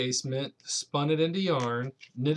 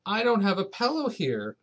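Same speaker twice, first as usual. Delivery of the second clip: put-on high, small child's plea; a little boy protesting to granny; small boy pleading; 6 semitones higher, 3 dB louder than usual. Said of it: "said Patrick".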